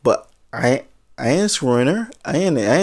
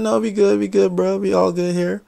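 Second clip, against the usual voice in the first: higher, easy-going we good; breezy reassurance; 6 semitones higher than usual. Retold or imitated. Man brushing off the guard, pitched up and casual.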